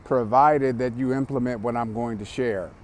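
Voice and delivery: ordinary talk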